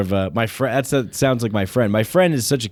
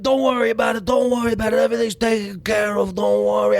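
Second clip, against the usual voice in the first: put-on Italian American accent; 9 semitones higher than usual. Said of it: "a way of talking".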